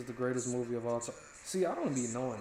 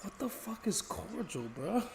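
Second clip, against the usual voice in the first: incredulous laugh; 7 semitones higher, 5 dB quieter, slower than usual. Real, not acted. Laughing disbelief at chat.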